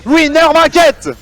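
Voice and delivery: loud, high, slow victory call; drawn-out triumphant shout; esports caster. Acted. Caster calls the series victory.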